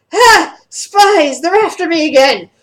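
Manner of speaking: high, loud cry of a paranoid madman; panicked loud shout. Acted. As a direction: shout in alarm at spies on your trail. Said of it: "He just, like, shouts loudly".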